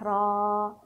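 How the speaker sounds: را with a long, held aa; lengthened vowel. Shown as a demonstration. Teacher showing pupils how alif lengthens the letter, drawn-out را. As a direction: stretch the alif sound in را. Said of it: "نمد الحرف على حسبها".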